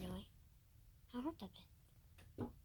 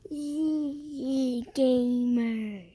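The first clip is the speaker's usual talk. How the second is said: loud, very slow, drawn-out name call; grand announcement; 11 dB louder, much slower than usual. Acted. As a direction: boom out the channel name like an announcer. Announcing channel name loudly.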